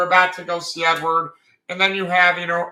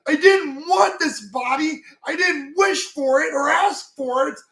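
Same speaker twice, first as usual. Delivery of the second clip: high, hurt yell at his brother; imitating furious shout; 9 semitones higher, 3 dB louder than usual. Alphonse snapping at Edward over his body, furious high yell. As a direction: snap back much higher and louder, slowing at first. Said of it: "Alphonse just snaps".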